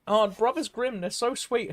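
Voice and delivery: mock gushing. Imitating everyone who loves Brothers Grimm, mock gushing. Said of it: "I love that everyone's like".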